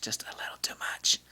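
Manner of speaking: breathy whispered aside. Real, not acted. Vlogger whispering a teasing aside about English reviewers. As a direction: whisper it as a secret. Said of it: "whispered aside".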